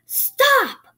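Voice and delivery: high, loud cry to halt; shout. A narrator's reading. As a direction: yell after someone running off. Captain calls after fleeing men.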